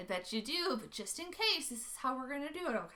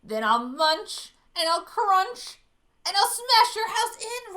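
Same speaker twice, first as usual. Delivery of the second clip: loud, slow menacing roar; big bad shark roaring at the little fish; 7 semitones higher, 13 dB louder, slower than usual. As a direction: roar each threat like a hungry shark. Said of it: "roared the shark".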